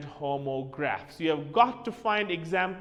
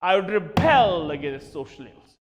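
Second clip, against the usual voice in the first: rebel stressed on the second syllable, ri-BEL; verb rebel; 7 dB louder than usual. Shown as a demonstration. Teacher modelling the verb rebel in a sentence, stress on bel. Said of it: "R E B E L as a verb".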